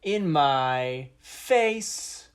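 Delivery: high, booming declamation with long-stretched words; hammed-up slam poet, slow and loud with dramatic pauses; poetry slam. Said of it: "what would that sound like".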